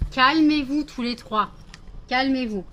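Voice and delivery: calm them down loudly and firmly; loud calming order. Real, not acted. Scene: owner ordering three excited dogs to settle, loud and firm.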